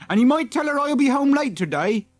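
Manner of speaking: a lightly voiced farm son. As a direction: toss it off in a hurry.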